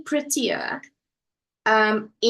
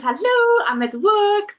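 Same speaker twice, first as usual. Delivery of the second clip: much higher, louder bright greeting; chirpy hello; 11 semitones higher, 5 dB louder than usual. Retold or imitated. Host reading a viewer's comment aloud, in a perky high voice. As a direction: read the comment with a perky lift. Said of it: "She says".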